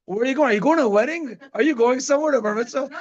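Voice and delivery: put-on high, quick child's questioning voice; imitating a suspicious kid; curious child. Dad imitating his kid quizzing him before a wedding, high and childlike.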